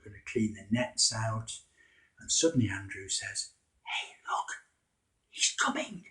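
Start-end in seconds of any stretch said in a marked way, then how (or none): 3.8-6.1 whispered, leaning in to share a secret; hushed whisper. Acted. Andrew whispering to Simon about Jesus, whispered. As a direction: breathe it out without voice, excited.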